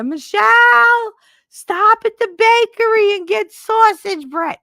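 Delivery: very high, loud, drawn-out holler; yelling from upstairs. Retold or imitated. Mother calling down from the ninth floor, high drawn-out yell. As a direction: holler an errand down to the street, slow and high.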